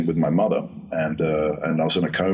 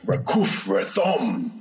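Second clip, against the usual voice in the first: slow, raised, otherworldly spell chant; druid spell incantation; 6 semitones higher, much slower than usual. Retold or imitated. Actor casting a druid spell on set, slow and intoned. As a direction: intone the made-up druid spell slowly as you strike the staff. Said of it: "I hit the ground, I went".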